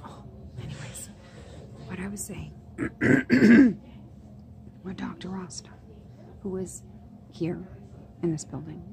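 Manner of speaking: near-whisper, keeping it down; hushed talk. Real, not acted. Waiting for the orthopedic surgeon, hushed whispering. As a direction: murmur so the waiting room can't hear.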